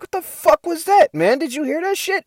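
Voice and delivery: high-pitched mock alarm; retold startled reaction. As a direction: react as if startled by a sudden noise. Acts out his startled reaction to creeping cop.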